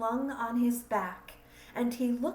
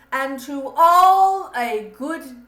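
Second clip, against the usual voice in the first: loud, higher festive exclamation, slower at the end; exclaiming; 3 semitones higher, 10 dB louder than usual. Acted. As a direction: call out a farewell while driving off. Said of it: "I heard him exclaim".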